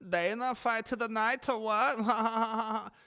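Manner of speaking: high, slow mock accent of the fighter; imitating his slurred callout. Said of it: "I could say it this time".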